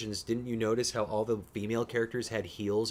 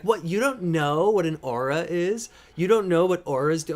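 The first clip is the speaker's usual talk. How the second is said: higher and louder, incredulous teasing; mock disbelief; 5 semitones higher, 8 dB louder than usual. Imitating V grilling him about auras, incredulous. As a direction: parody V's outrage that he doesn't know Harry Potter. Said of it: "She'll sit there and be like".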